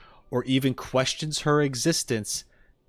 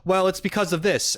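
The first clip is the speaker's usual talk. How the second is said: raised-pitch mock commenter voice; higher, mocking know-it-all quote; imitating commenters; 8 semitones higher, 4 dB louder than usual. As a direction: mimic a pushy commenter, pitched up. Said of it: "and be like".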